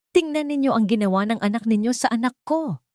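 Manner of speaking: put-on an outraged mother calling out the wrong done to her child; high, indignant accusation; angry mother's shout. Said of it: "sigaw ng ina".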